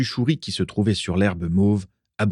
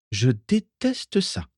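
slow, drawn-out, higher distaste; disgusted grimace; 5 semitones higher, much slower than usual. A narrator's reading. Vera complaining about the sucking leaves, disgusted. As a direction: grimace through the words, slowly. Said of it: "grimaça Vera".

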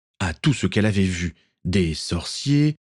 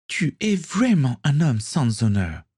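higher, slow reproachful cry; indignant cry; 7 semitones higher, slower than usual. A narrator's reading. Sister scolding her brother, indignant cry. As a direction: accuse him of betrayal. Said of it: "s'écria Kathleen".

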